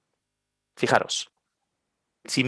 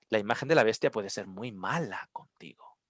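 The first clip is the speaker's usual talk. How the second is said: hushed, low warning whisper; ominous whisper; 5 semitones lower, 11 dB quieter than usual. Acted. Preacher whispering a threat to the congregation, hushed.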